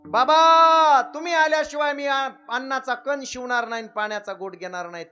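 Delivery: high, loud wailing plea; wailing vow. Retold or imitated. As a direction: beg through tears.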